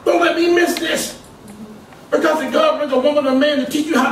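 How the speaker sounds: rising sermon shout; fiery preaching. Acted. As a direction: preach it hard at the congregation. Preaching to the church.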